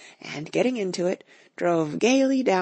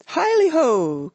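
loud, high, slow, drawn-out hail; jolly cry; 10 semitones higher, 6 dB louder, slower than usual. A narrator's reading. Fezziwig rallying his lads, loud joyful cry. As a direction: sing it out like a merry old boss. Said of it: "cried old Fezziwig".

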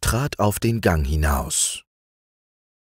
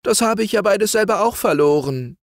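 put-on higher young man's exclamation; Franz exclaims; 14 semitones higher, 3 dB louder, faster than usual. A narrator's reading. Youth cries out he has lost home too, higher. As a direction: cry out in shared sorrow. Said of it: "rief Franz".

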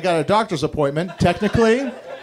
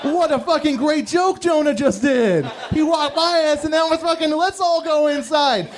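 high, slow gleeful dog voice; excited dogs; 7 semitones higher than usual. Retold or imitated. Dogs hearing a commotion, high gleeful voice. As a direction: cheer like pups ready to play. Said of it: "they're like".

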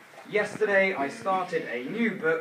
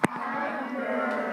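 teacher drawing the word out slowly for the class; slow drawn-out word; 6 semitones higher than usual. Shown as a demonstration. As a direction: stretch adverbs out slowly, high. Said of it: "say it slowly".

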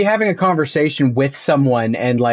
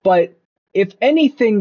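usual speaking voice

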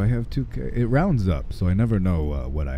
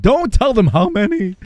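mock protest; 7 dB louder than usual. Jokingly hushing chat.

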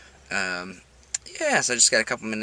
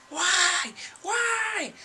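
mock despair. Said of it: "I don't know why".

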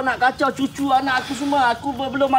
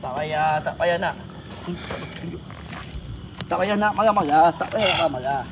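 soothing hush; 9 semitones lower, 6 dB quieter than usual. Real, not acted. Calming an angry spirit.

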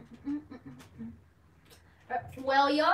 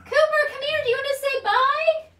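high sing-song calling the dog, louder; dog-talk calling; 14 semitones higher, 8 dB louder than usual. Real, not acted. Owner calling her dog to say bye, high sing-song baby talk.

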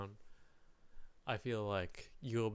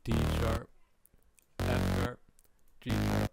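loud callout; 8 dB louder than usual. Shown as a demonstration. Calling out the chosen notes.

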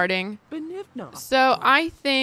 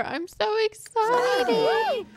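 very high, drawn-out squeal; real thrilled squeal; excited; 14 semitones higher, much slower than usual. Real, not acted. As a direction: squeal with anticipation. Toddler about to appear.

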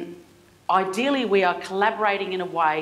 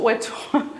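laughing; 9 semitones higher than usual. Real, not acted. Laughing after Google admission.

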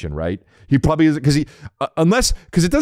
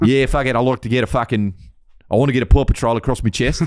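mock Australian toddler; Australian accent. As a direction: put on a broad Aussie accent. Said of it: "no tattoos in Australia".

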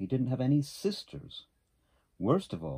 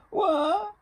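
very high, loud pretend sobs; hammed-up sobs into a piercing wah; echoed mock wail; 10 dB louder than usual. A narrator's reading. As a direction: bawl back as the crying echo. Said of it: "said the voice from across the pond".

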